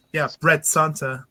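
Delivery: ordinary delivery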